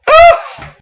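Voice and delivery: loud, high theatrical gasp; mock gasp. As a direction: gasp as if stunned by the reveal. Mock reaction to twist.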